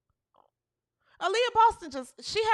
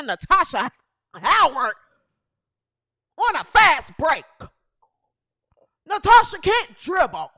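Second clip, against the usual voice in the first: exasperated shout; 6 dB louder than usual. Real, not acted. Ranting about bad passes.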